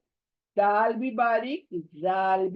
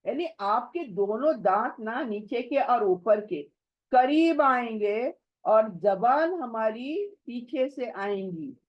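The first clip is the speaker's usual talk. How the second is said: ordinary delivery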